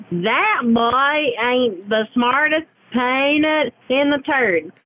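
slow folksy Southern twang; Southern drawl. Acted. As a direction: drawl it out, country style. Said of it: "let me show you how it's done".

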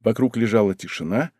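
ordinary delivery, nothing marked

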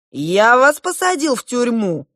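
high, loud incredulous outburst; sincerely astonished. A narrator's reading. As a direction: protest it in amazement, pitch soaring. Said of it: "искренне удивился старший принц".

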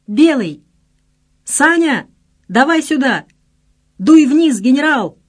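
loud, high shouts up to a window; calling from below. A narrator's reading. Friends calling the soldier down from the street, shouting. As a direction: holler up at him to come down. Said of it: "неслось снизу".

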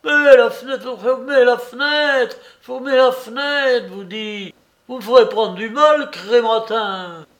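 high, loud frantic shouting; frantic old man's outcry; panicked clamour. A narrator's reading. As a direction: yell in panic for it to be shut. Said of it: "les clameurs affolées du pauvre homme".

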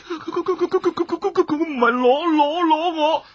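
high, broken, sobbing stutter; frightened tearful stammer; terrified stammering. Acted. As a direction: stumble over every word in fear. Man refuses, terrified, stammering.

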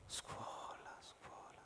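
breathy whispered repeat of scuola; whispered echo. A narrator's reading. Narrator murmuring scuola to himself, whispered. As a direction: whisper it to yourself, fading.

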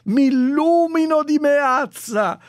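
high, loud mock acclaim, slowing; hammed-up fan praise. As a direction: cheer like a gushing fan. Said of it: "è un profluvio di". (acclamazione esagerata da fan)

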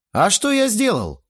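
high-pitched, obstinate question; defiant protest. A narrator's reading. Teenager refuses father's order to apologise, defiant. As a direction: object as the wronged party. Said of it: "строптиво подбоченился я".

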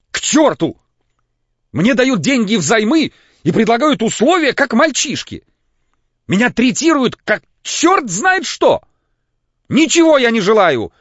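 очень высоко и громко, срываясь; кричит, шатаясь от волнения; истеричный крик. A narrator's reading. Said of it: "крикнул Лаевский". (истерический вопль, высоко и громко)

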